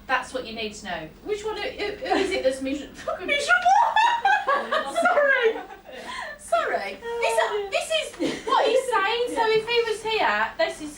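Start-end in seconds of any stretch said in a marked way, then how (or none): 3.3-5.0 high, loud burst of laughter; helpless high giggle; laughing. Real, not acted. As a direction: crack up on the word. Medium cracks up mid-word.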